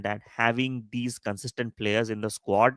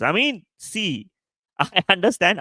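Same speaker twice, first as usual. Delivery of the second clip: laughing; 7 semitones higher, 3 dB louder than usual. Real, not acted. Laughs at a viewer's comment.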